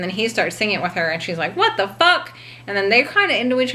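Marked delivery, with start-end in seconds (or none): 1.6-2.4 shocked. Retold or imitated. Shocked woman in shower.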